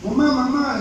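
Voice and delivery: high child's call, mock yelling mamá; girl shouting for mom. Little girl calls her mother from the back, high mock shout.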